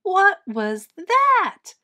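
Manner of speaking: put-on high, loud, drawn-out baby bird cry; startled cry. A narrator's reading. Baby bird startled by a stranger, crying out. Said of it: "cried Flap".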